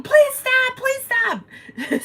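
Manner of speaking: high, pleading imitation of a begging woman; pleading lady. Imitating a woman on foot begging the scooter driver to stop, high and pleading.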